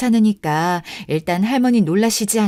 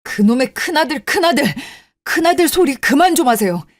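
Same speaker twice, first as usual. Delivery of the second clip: angry outburst; 5 semitones higher than usual. Acted. Youngest son to his mother who only asks for the eldest, angry outburst. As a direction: explode with pent-up resentment.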